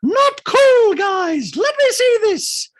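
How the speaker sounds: very high, loud mock shriek; hammed-up screeching protest; screaming. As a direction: scold the group at a shriek. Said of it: "And Dorit screams".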